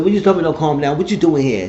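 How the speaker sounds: loud offended questioning; angry retort. Retold or imitated. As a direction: bite back at calm down. Betty snapping back at him.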